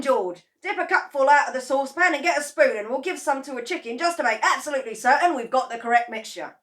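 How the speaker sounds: loud, high, rushing rant; excited rant. A narrator's reading. Mister Cranky ranting to his wife, loud. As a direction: rant with glee.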